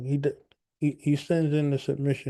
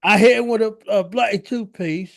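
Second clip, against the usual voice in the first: put-on British accent; 7 semitones higher, 5 dB louder than usual. Mock British brawler.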